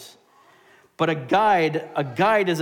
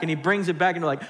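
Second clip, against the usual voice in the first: ordinary delivery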